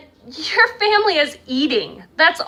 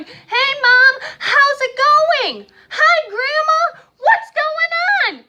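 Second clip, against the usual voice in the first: mock shout, much higher and louder, drawn out; yelling into the phone; 12 semitones higher, 3 dB louder, much slower than usual. Parent shouting greetings to Grandma over a bad line, mock yell. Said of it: "yelling and shouting".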